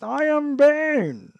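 high, slow villain impression; Bane voice. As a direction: loom like the masked villain. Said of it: "the voice they use for Bane in this is very much Bane".

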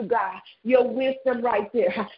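ordinary talk

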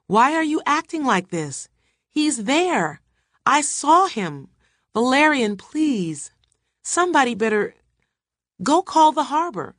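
high, frantic pleading cries; screaming. A narrator's reading. Wife begs husband to believe her, screaming. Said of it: "screaming again and trying to stand".

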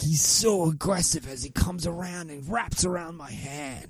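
mock-Australian wildlife host; Steve Irwin. Showing off a pretend snake, Australian presenter voice. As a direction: narrate a snake encounter in cartoon Aussie. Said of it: "It's Steve Irwin".

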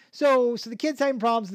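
ordinary talk

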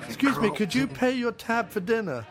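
high-pitched caricature voice; mock polite request. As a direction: pipe up in a put-on voice. Acting out a quote.

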